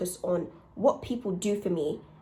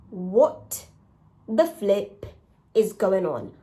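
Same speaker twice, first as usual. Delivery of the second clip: louder, each chunk set apart by pauses; baffled disbelief; 6 dB louder, much slower than usual. Retold or imitated. Let-down friend reacting to no congratulations, baffled disbelief. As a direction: punch it out in pieces, incredulous. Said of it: "but you're kind of like".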